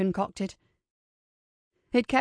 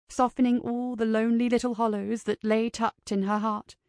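usual speaking voice

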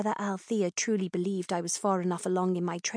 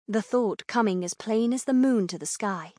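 usual speaking voice

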